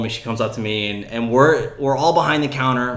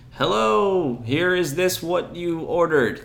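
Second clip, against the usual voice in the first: higher, slowing at the end; friendly food runner sing-out, pitched up; nice greeting; 6 semitones higher, slower than usual. Retold or imitated. Food runner bringing plates to diners, nice greeting. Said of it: "give people a nice".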